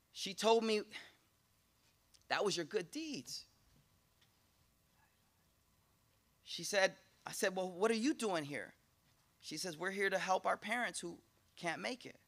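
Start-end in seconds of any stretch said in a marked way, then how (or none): 2.3-12.2 much softer, gentle; soft tender hush. Acted. Father and daughter talking in the dream, soft and tender. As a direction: speak it like a secret gift.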